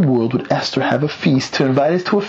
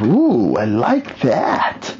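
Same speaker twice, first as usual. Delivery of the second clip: drawn-out, swooping mock delight; playing a villain slowly relishing a scheme; retold relish; much slower than usual. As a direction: savor the idea very slowly.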